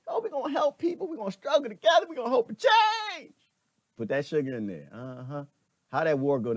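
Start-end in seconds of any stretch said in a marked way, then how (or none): 0.1-3.3 high, sugary mock politician voice, building louder; sweet-talking politician. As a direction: mimic a smooth talker, sweet and high. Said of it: "He talked real cute".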